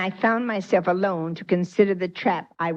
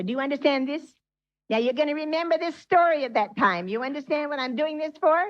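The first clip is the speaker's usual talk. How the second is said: higher, urgent preacher's appeal; preaching; 8 semitones higher than usual. Acted. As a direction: press the flock to remember. Preacher urges the congregation.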